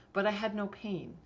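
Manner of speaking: normal talking voice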